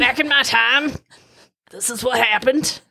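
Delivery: lightly voiced old man, laughing; a hint of a doddering grandpa; old gentleman. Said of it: "I sound like an old gentleman".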